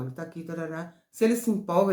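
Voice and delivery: ordinary talk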